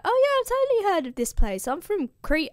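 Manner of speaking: high-pitched, overdone feigned nonchalance; playing it cool. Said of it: "still plays it very cool".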